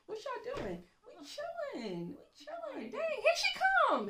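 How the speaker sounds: very high, giggly squeal; mock slacker panic. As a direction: play workers caught idling by the boss. Acts slackers as the boss approaches.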